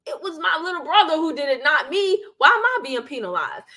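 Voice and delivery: put-on high-pitched whiny child protesting; whining kid. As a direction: complain like a wronged little sibling. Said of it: "And you're like".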